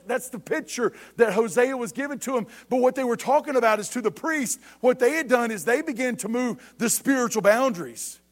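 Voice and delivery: high, insistent sermon voice; emphatic preaching. Real, not acted. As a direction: preach the point home. Preaches the point.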